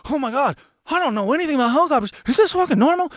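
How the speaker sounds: high, loud, rushed panic; panicked. Retold or imitated. Rookie panicking to the pilot as the helicopter shakes, high frantic voice. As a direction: blurt it out in a rising panic.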